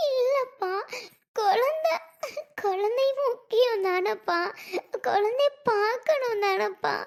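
high, tearful sobbing plea, slowing down; crying. Acted. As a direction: beg through tears for the baby. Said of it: "இப்படி அழுக விட்டுட்டு". (அழுதுகொண்டே கெஞ்சும் குரல்)

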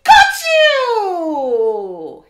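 loud, high, long-held yell; triumphant pouncing shout. Acted. Hippo grabbing the bird, loud gleeful shout.

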